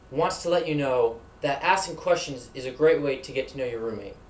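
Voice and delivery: usual speaking voice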